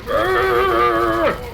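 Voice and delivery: loud growl of excitement; excited growl. Retold or imitated. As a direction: growl like a hyped fan. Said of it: "and I was like".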